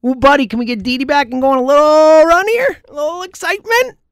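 getting pumped at the thought of a winning run; excited hype. Real, not acted. Phillies fan hyping up listeners about Didi's return, excited and rising.

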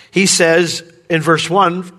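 ordinary talk

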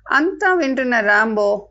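ordinary delivery

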